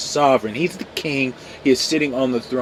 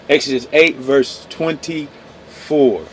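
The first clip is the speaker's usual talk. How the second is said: slow, careful restating of the verse; slow correction; slower than usual. Real, not acted. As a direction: read the verse number again slowly. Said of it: "Oh, I'm sorry, twenty-four".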